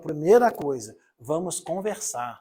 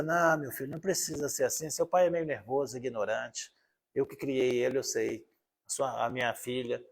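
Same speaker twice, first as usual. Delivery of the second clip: lightly voiced grandparent, lower and softer; understanding grandparent; 5 semitones lower, 5 dB quieter than usual. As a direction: soothe the grandchild in a low, soft voice. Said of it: "chega lá naquele vô".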